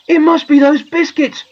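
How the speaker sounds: high, loud wail of dismay; groaning. A narrator's reading. Poor boy blaming the biscuits, groaning. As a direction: lament it in high-pitched distress. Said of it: "groaned poor John".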